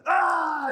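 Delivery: loud, high re-enacted yell; mock yell. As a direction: cry out high and loud, acting out a panic. Retold yell while grabbing host.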